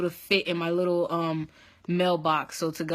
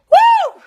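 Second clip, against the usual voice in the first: piercing, overwhelmed "woo"; sudden release of a whoop; loud high whoop; 14 dB louder than usual. Real, not acted. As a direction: let out a big high woo. Overwhelmed exclamation telling story.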